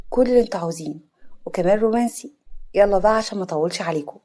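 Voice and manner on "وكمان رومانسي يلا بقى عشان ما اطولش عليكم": plain reading voice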